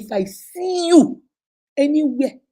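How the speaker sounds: high, loud, drawn-out threat; imitated threatening warning. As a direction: act out a stern warning, stretching the words. Retells father's threat on leaving them.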